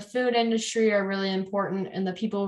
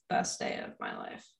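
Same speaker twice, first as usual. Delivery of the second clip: whispering; 13 dB quieter than usual. Shown as a demonstration. Repeating the phrase, trying voices.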